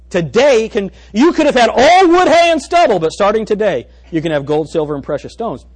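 high, loud jubilant preaching; preaching at full force. Acted. Preacher shouting hope to the congregation, high and loud. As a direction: lift them with the good news. Preacher peaks on good news.

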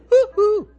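sudden high, loud falsetto whoop. Retold or imitated. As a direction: toss off a pop-star hoot, way up high. [name] falsetto whoop imitation.

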